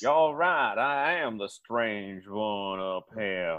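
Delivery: put-on low, slow, soft southern accent; southern gentleman accent. Stranger admitting he is odd up here, southern accent. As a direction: concede in a mock-courtly southern accent. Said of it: "a southerner in King Arthur's New England".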